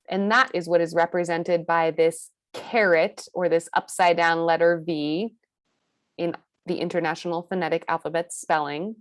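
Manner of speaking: usual speaking voice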